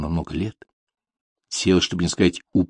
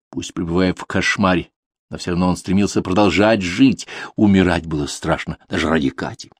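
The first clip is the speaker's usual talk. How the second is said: usual speaking voice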